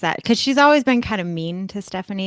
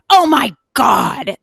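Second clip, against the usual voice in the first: loud, higher, drawn-out "oh my God"; appalled outburst; 7 semitones higher, 7 dB louder, slower than usual. Retold or imitated. Neighbour reacting to a barking dog, retold appalled outburst. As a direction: exclaim it slowly, imitating an appalled neighbour.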